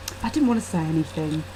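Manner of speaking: ordinary delivery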